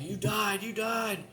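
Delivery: high, urgent repeated insistence; family insisting. Retold or imitated. Relatives insisting he died, high urgent. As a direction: raise your voice like scared relatives. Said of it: "they're like".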